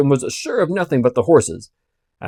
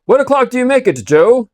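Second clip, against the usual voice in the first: calling out; 10 semitones higher, 5 dB louder than usual. Acted. Coachman calling to guard.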